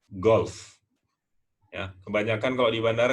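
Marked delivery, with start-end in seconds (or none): 0.1-0.8 Golf said as one syllable, golf; correct Golf. Shown as a demonstration. Teacher models Golf after the Golop mistake. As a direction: clean English Golf, no extra vowel. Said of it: "bukan Golop".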